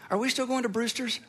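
lightly voiced little boy, hopeful; a hint of an eager kid; ten-year-old asking. Ten-year-old boy asking dad about ice cream, lightly voiced. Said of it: "what their biggest concern is".

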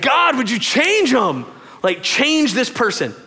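high, drawn-out mock pleading; exasperated prayer. Praying God would change the other person, exasperated plea. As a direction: beg heaven in hammed-up frustration. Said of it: "nights where I would pray".

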